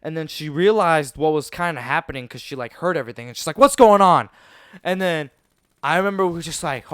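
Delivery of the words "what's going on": loud, higher-pitched imitated teacher; teacher's sharp demand. Teacher discovering a playground fight, loud demand. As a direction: cut in loudly, demanding answers.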